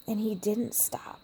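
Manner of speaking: ordinary talk, nothing marked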